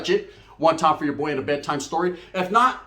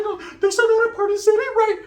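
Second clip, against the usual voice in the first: much higher, louder comic voice; high put-on voice; 3 dB louder than usual. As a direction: squeak it out like a cartoon. His own retold line.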